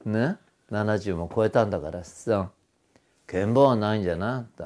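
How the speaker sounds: low imitation of a senior singer's reproof. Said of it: "諭すように。".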